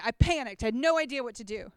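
ordinary delivery

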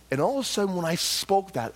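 ordinary talk, nothing marked

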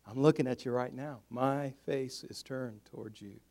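soft, low, slowing reassurance; very calm father. Acted. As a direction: soothe a child at bedtime, unhurried. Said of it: "in a very calm voice".

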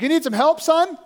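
lightly voiced high call from above; a hint of a helpful stranger calling. Stranger offering help to stuck climber, lightly called out.

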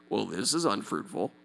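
a low muttered deadpan of the doubting mind; mock puzzled mind. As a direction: play the mind shrugging, flat and unimpressed. Voicing the confused mind.

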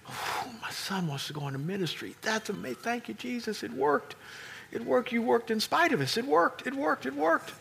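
high, soft overjoyed thanks; breathless delight at answered prayer; overjoyed parent. Retold or imitated.

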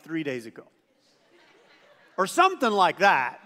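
ordinary talk

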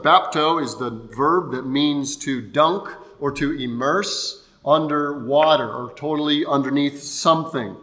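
ordinary talk

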